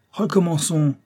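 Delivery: exasperated teacher's command; impatient order. A narrator's reading. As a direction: order the pupil to start over.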